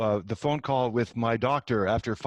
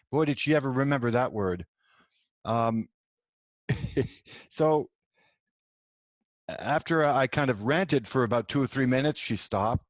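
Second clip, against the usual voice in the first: normal talking voice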